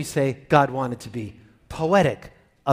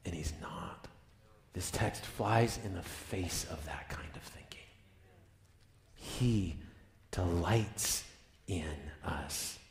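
whispered, much softer, intimate; hushed whisper; 10 semitones lower, 16 dB quieter, slower than usual. Real, not acted. Preacher hushing the congregation to a whisper. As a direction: lean in and whisper the truth. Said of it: "preacher drops to a hush".

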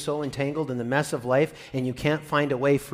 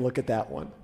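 soft laughing nudge, much quieter; fond teasing; 6 semitones lower, 8 dB quieter than usual. Pretend. God nudging about Jesus, softly laughing. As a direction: tease him gently through a laugh. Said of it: "giving Jesus the needle going".

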